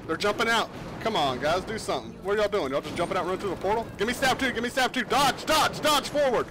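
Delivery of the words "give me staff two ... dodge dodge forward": shouting orders over battle. Real, not acted. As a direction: bark orders over the fight noise. Commander calling orders in heavy fight.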